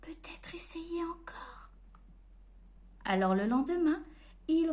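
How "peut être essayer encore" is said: hushed inner voice; whispered thought. Acted. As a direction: wonder aloud in a discouraged whisper. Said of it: "pensa-t-il".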